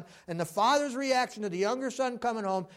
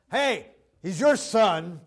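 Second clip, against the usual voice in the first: louder, slow and disowning; resentful brother; 6 dB louder, slower than usual. Retold or imitated. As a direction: push the brother off onto Dad. Elder brother confronts father.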